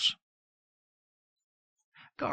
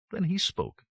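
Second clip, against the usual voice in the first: plain reading voice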